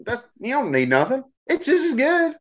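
high sing-song propaganda voice; dystopian announcer. Pretend. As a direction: sell it sweetly to the masses.

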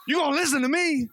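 high, fast, hammed-up shout; bully them into listening, mock; mock yelling demand. Husband to his wife, demanding she listen, hammed-up shout.

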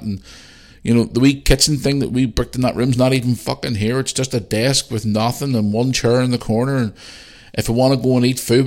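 high, loud run-on list of grievances; ranting and raving. Retold or imitated. Parents complaining to son about the hotel room, ranting. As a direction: pile up complaints, outraged, leaning on each one. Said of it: "rang me first to rant and rave".

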